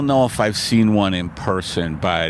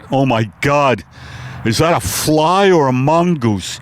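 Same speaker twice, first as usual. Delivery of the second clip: a dazed horse struggling to breathe; Mister Ed on acid; 3 semitones higher than usual. Acted. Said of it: "my impersonation of Mister Ed".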